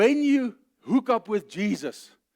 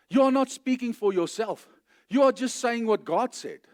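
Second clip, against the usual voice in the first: ordinary talk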